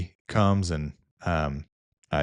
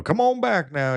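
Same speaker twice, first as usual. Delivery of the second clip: high, hammed-up Southern send-off; over-the-top Southern holler; boisterous Southern gentleman; 12 semitones higher, 4 dB louder than usual. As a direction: imitate a big friendly oilman calling out. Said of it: "you know, Southern gentleman".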